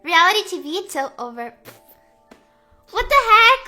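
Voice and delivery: usual speaking voice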